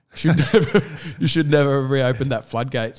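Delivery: half-laughing mock warning; laughing threat. Telling his friend, laughing at his own vow.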